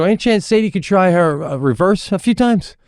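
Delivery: high, careful re-enacted request; tentative asking. Dad asking coach to let his daughter try, tentative. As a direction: ask a favour in a lifted voice. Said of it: "just like this, I go".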